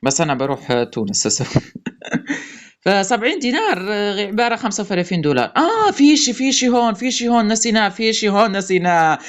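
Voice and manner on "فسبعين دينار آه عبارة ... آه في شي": ordinary delivery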